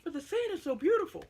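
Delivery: high, gushing mock of people praising the sand; mock-gushing Southern imitation; mocking quote. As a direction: parrot them in a sing-song, mocking voice. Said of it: "People say".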